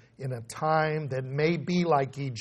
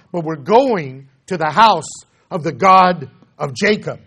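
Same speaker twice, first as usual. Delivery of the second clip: louder, driving preacher's proclamation; full-force preaching; 2 semitones higher, 10 dB louder than usual. Acted. Preacher proclaiming the prophets' promise to the congregation, full-force preaching.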